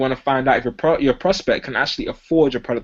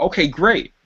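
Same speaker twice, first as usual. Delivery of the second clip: flat okay great; 3 semitones higher than usual. Shown as a demonstration. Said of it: "you don't wanna say".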